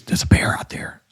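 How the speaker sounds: urgent whispered warning; hushed warning about the bear. Retold or imitated. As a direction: breathe it out tight and scared. Wife warns of a bear.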